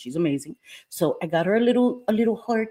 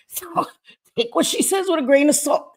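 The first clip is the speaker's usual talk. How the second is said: laughing; 9 semitones higher than usual. Real, not acted. Laughing aside to viewers.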